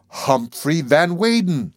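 slow, lingering on the name; slow conclusion. Acted. Maud naming the narrator, slowly and wondering. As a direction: savour the name as it dawns. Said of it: "she concluded".

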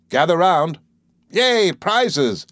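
cried out. A narrator's reading. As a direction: announce the prizes to the crowd. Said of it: "he cried".

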